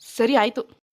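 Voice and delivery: normal talking voice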